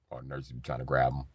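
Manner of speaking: ordinary delivery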